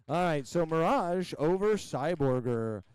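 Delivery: ordinary delivery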